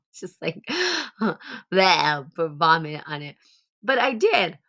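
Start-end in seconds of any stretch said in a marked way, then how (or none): 0.7-1.7 mock gasp. Mock disgust at the answer. 1.7-2.3 mock gag. Mock disgust, vomiting noise.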